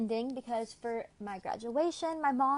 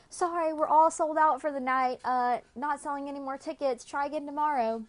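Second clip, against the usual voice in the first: higher, louder brisk announcement; loud clerk announcement; 5 semitones higher, 5 dB louder than usual. Retold or imitated. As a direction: call out the sold-out news to the line. Theater lady announcing sold out.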